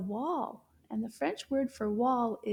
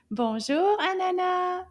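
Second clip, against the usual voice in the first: nice loud voice; 5 semitones higher, 4 dB louder than usual. Shown as a demonstration. Teacher leading the class in calling Anana, nice loud voice. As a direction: call out to summon someone. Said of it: "in a nice loud voice".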